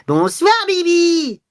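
very high, drawn-out squeal; cri suraigu. A narrator's reading. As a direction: street urchin's piercing, stretched-out taunt. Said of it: "cria avec un accent suraigu". (cri suraigu et traînant de voyou)

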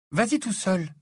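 high, curt brush-off; tossed-out retort. A narrator's reading. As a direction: throw it back at him, irritated. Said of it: "lança Ginny".

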